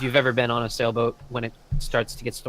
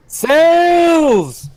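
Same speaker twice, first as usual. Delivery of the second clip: huge high yell from the masthead; lookout yells; 13 dB louder than usual. Acted. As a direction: shout it down from the top of the mast.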